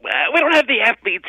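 high squeaky voice; Mad Dog impression. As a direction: squeal it apologetically. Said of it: "Mad Dog goes".

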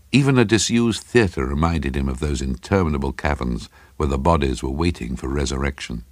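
usual speaking voice

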